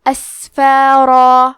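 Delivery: اسفارا very slow, with a hissed sin and a long, heavy ending; اسفارا chanted slowly, the ending stretched out; hams on sin. Shown as a demonstration. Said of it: "تو ہم یہ کلمہ کو ایسے پڑھیں گے".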